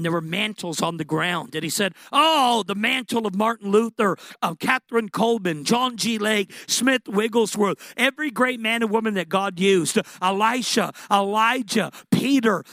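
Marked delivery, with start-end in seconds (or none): 2.1-12.6 a loud, high, wonder-struck cry of names; excited awe. Retold or imitated. Young man in a vision calling out mantles, excited and loud. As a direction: shout out each name as if you can't believe it.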